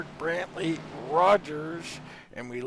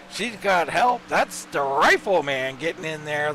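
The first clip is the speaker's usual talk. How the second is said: excited race call; 4 semitones higher, 6 dB louder than usual. Real, not acted. Calls the green-flag race start.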